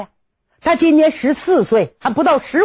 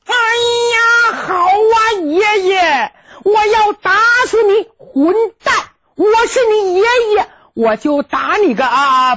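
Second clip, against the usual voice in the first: shouting fight; 4 semitones higher than usual. Acted. Staged fight between grandfather, grandson.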